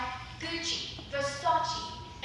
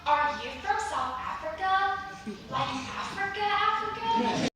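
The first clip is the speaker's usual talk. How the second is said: mock American; 6 semitones higher than usual. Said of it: "they'll ask you what language".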